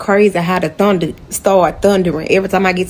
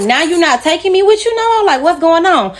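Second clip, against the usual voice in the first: acted-out indignant questioning, pitched up; indignant confrontation; 8 semitones higher than usual. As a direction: confront him in a high, put-out voice. Confronts him in retold quote.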